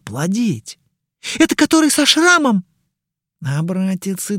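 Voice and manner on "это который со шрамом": excited fan. Acted.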